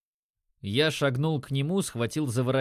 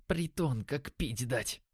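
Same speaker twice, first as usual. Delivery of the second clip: hushed and softer, with distaste; disgusted whisper; 7 dB quieter, slower than usual. A narrator's reading. Димон to his partner in the drug den, whispering with disgust. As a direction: mutter it under your breath in disgust. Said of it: "прошептал Димон с отвращением".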